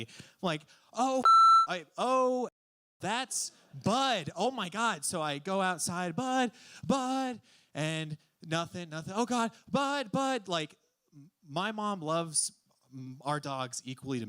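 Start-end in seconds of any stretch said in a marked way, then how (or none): 0.9-5.0 high, loud rising panic, gasping it out; panicked realization. Retold or imitated. Teen realizing his dog escaped the party, panicking. 6.1-7.4 calling the dog. Retold or imitated. 9.2-10.5 worried calling. Retold or imitated. Can't find his dog in the dark, calling anxiously.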